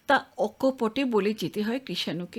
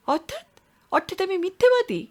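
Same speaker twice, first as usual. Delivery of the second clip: rising, louder indignant retort; 9 semitones higher, 3 dB louder than usual. Acted. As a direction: bristle at being called a liar. Accused of lying, indignant. (ক্ষুব্ধ প্রতিবাদ)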